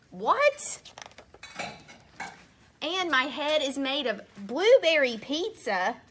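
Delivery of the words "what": high, rising What? of disbelief; mock disbelief; incredulous What?. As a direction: squeak it in mock shock. Reader baffled by robot monkey.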